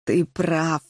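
low, stern admiral voice. A narrator's reading. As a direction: agree gravely, pitched low. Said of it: "сурово кивнул Румпель".

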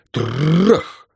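long rolled-r crash; trilled, rising bang; crashing noise. Acted. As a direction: imitate a battering ram hit. Imitated battering ram crash.

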